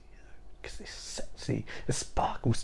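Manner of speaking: very soft, whispered aside; whispered, mock-sultry confiding; hushed whisper. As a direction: whisper it like a naughty secret. Confides what she finds sexy.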